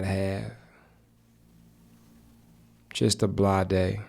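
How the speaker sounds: unhurried meditation guide, long pauses; measured, even meditation voice with spacious silences; guided meditation. Acted. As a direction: guide listeners gently into stillness, phrase by phrase.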